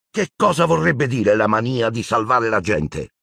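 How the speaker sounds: indignant. A narrator's reading. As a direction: throw the phrase back at her. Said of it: "Harry la fulminò con lo sguardo". (offeso, indignato)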